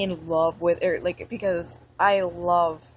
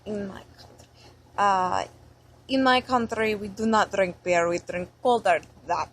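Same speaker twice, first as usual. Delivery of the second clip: thick put-on Russian accent. Shown as a demonstration. Vlogger showing off an accent they are good at, Russian accent. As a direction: play a Russian, each word heavily accented. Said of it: "I will do another accent".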